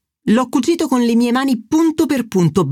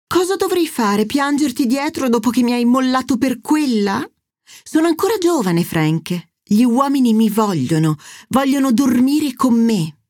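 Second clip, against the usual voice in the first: higher, indignant mother throwing it back; flaunting mother, pitched up; mother flaunting her youth at her ex-husband, high and indignant; 4 semitones higher than usual. Acted. Mother Angela fights with her ex.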